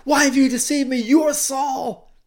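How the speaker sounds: loud, high outraged cry. Acted. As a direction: shout it at the top of your voice, acted. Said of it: "cried out at the top of her voice".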